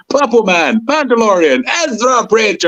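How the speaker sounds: high-pitched, exclaimed roll-call of nicknames; Hondo impression. Fan quoting Hondo greeting Ezra, playful high-pitched impression. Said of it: "they break out Hondo".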